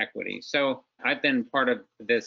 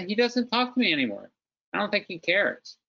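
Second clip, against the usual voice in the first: higher-pitched, mildly aggrieved retold complaint; imitating a father who feels slighted; hurt complaint; 7 semitones higher than usual. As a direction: voice dad's grievance, pitched up. Retelling his late father's hurt complaint.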